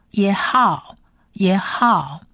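usual speaking voice

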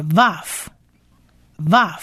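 vav said slowly as "vahf", twice with a pause; sounding out vav. Shown as a demonstration. Teacher joins consonant and short vowel, slow vav demo. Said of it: "所以是".

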